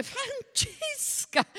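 very high, drawn-out alarmed call; alarmed cry. A narrator's reading. Jeannine cries out as the child yells, alarmed. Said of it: "gridò Jeannine".

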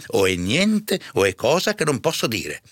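curato evasivo, voce più alta; curato agitato. A narrator's reading. (curato agitato che non vuole parlare)

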